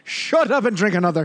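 laughing Irish pub brogue; bad Irish-Scottish accent through laughter; mock Irish accent. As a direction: bark it like a barman with a grin. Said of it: "bad Irish slash Scottish accents".

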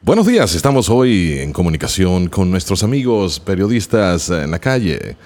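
bright Spanish radio announcer read. Shown as a demonstration. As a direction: read it like morning traffic radio. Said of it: "that, uh, amazing radio voice that you have".

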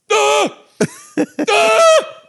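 high, loud mock screams; hammed-up shrieks of horror; Keanu Reeves. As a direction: scream like a badly acted man going mad. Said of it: "like a man going mad".

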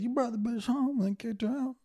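high-pitched incredulous accusation; high, scandalized jab; mock disbelief. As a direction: squeal it in mock outrage. Listener's mock disbelief at owner.

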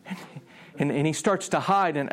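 ordinary talk

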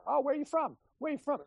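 high, mocking local asking where you're from; nosy Chicago local. Teasing Cubs fan about strangers: high mock nosy voice. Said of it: "people are like".

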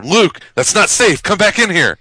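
high, loud fussy-parent voice; a worried dad calling his kid back; Darth Vader. Retold or imitated. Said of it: "it's Darth Vader saying".

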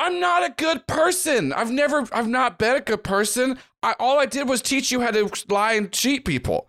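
high-pitched, self-pitying admission; a washed-up mentor owning her failings; confessing NPC. Acted. NPC confesses to former student.

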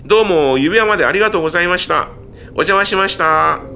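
brisk perky reporter wrap-up. Acted. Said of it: "レポーター役". (テレビリポーター風の締め)

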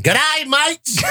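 hammed-up Australian hello; Australian accent. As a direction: shout it like a cartoon Aussie. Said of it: "Australia, man".

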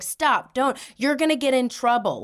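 high-pitched bossy warning. Retold or imitated. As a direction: imitate a sister telling off her brother. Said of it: "she was being kinda bossy".

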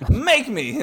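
slow, laughing dare; defiant taunt. Retold or imitated. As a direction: taunt them with a grin. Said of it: "he's like".

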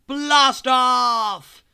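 loud, high triumphant shout; launch shout. Acted. Narrator cries out the rocket launch in a loud shout.

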